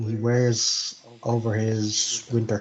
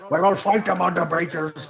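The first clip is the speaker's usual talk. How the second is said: rousing speech parody; 6 semitones higher than usual. Pretend. GM mocks Thor's rally speech, higher mock orator. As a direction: ham up a wartime rallying speech. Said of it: "while Thor is giving his spiel".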